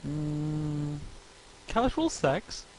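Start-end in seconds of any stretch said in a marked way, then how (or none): 1.7-2.6 curious inquiry. Acted. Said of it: "Inquired Magnet".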